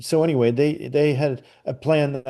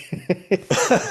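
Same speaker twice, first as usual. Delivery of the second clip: laugh; 8 semitones higher than usual. Real, not acted. Laughing at own story.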